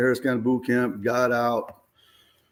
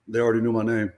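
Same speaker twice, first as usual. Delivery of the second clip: ordinary talk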